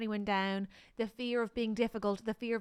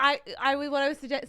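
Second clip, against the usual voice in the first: ordinary delivery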